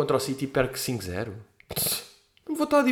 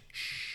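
hushing shh; 16 dB quieter than usual. Acted. Hushing again.

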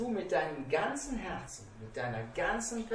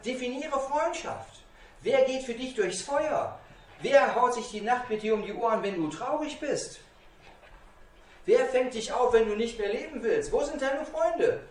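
raised, pressing questions, high and loud; insistent challenge; 7 semitones higher, 6 dB louder than usual. Retold or imitated. Speaker challenges a youth boasting of online friends, high insistent questioning. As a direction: challenge them harder with each question.

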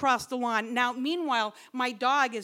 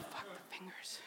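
hushed whisper of dismay; 4 semitones lower, 22 dB quieter than usual. Retold or imitated. Muttering to herself as the rescue guy leaves, whispered. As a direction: breathe it out under your breath. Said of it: "And I'm like".

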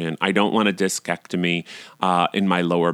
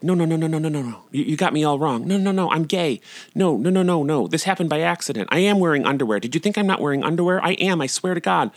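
panicked pleading; 7 semitones higher, faster than usual. Retold or imitated. Panicked denial to the yoga teacher.

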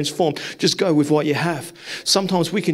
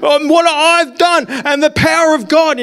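eager pitch; 10 semitones higher, 5 dB louder than usual. Retold or imitated. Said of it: "I'd be like".

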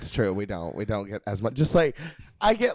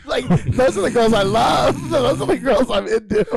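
laughing; 10 semitones higher, 7 dB louder than usual. Real, not acted. Laughing about girls she loves.

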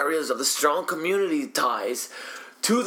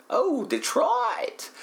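slow, drawn-out high cry of "oh, Detroit"; overjoyed drawn-out wail; excited wail; 11 semitones higher, much slower than usual. Real, not acted. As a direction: stretch it out like a delighted howl. Excited to see Detroit listed.

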